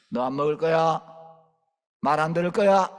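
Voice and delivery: loud, rising demands; stern threat. Retold or imitated. Preacher threatening a stubborn girl, loud. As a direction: corner the child.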